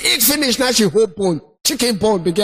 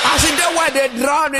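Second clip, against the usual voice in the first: ordinary talk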